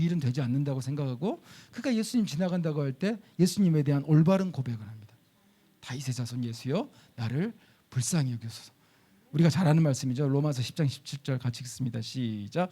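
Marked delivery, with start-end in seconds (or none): 5.8-8.7 낮고 부드럽게 되뇌는 고백; 속으로 믿음을 고백하듯; 조용한 고백. Shown as a demonstration. 바른 고백을 조용히 인용. (예수님께 드리는 조용한 고백)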